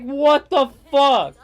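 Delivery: indignant, drawn out; loud slow what the fuck. Real, not acted. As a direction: blow up at the thumbs up.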